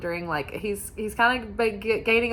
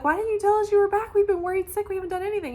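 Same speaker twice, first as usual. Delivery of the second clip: much higher, fast, frantic complaining voice; panicked disciples; 12 semitones higher, much faster than usual. Retold or imitated. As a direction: squeak out the worried friends' reproach.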